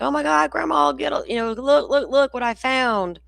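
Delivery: put-on high, excited little boy voice, slowing at the end; excited grandson. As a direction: imitate an eager child showing off a find. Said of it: "my grandbaby's like".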